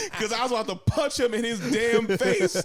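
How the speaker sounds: high, loud, laughing confession; through laughter. Real, not acted. Admitting he nearly punched his homie, laughing hard. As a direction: barely get it out while cracking up.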